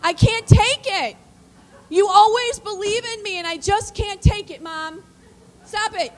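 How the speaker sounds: high, loud anguished yelling; retold scream. Son yelling at his mother, high and loud. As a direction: son screaming at mom to stop believing in him. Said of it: "He screamed at me".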